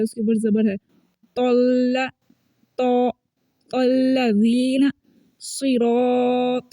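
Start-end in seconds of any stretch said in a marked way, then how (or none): 1.4-6.7 तल्लज़ीना with a thick, emphatic T and rounded "o" colour; slow tajweed demo, heavy T in तल्ला; full-mouthed heavy recitation. Shown as a demonstration. Teacher showing learners the heavy letter in तल्लज़ीना, full-mouthed. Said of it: "मोटा पुर पड़ा जा रहा है".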